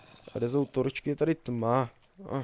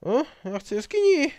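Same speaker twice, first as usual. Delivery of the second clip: excited; 5 dB louder than usual. Real, not acted. Excited outburst in cave.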